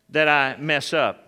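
normal talking voice

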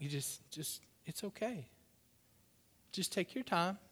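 soft, hesitant soothing; calm them down very quietly; gentle reassurance. Acted. Shopper to frazzled cashier on first day, gently reassuring.

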